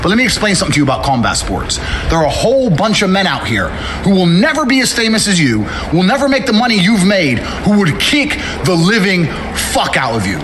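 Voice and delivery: loud, firm callout delivered straight; formal callout speech. Acted. Fighter calling out Jake Paul, loud firm challenge.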